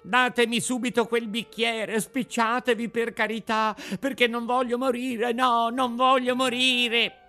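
high, loud desperate pleading; shrieking puppet. A narrator's reading. Puppet begging for the glass, desperate shriek. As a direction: beg at a squeal, in panic. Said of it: "cominciò allora a strillare il burattino".

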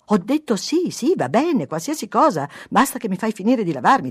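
loud, high, irritated shout back; exasperated. A narrator's reading. Husband washing, answering wife, exasperated.

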